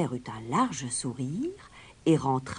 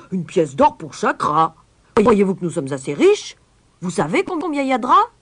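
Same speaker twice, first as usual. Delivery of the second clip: loud outraged roar; mayor roaring; 6 semitones higher, 11 dB louder than usual. Acted. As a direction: roar the price back at the stranger. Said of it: "puis il rugit". (le maire rugit d'indignation)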